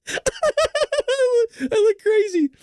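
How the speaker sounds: high, dragged-out real laughing fit; crying with laughter. Real, not acted. Telling viewers about his kitchen laughing fit, real helpless laughter. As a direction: lose it, laughing till near tears. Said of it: "Like, I'm fucking crying".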